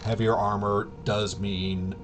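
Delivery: normal talking voice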